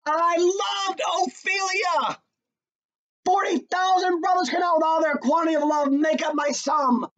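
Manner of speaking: high, loud cry of grief and rage; anguished shout. Acted. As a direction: shout your love for her at Laertes. Hamlet shouting at Laertes.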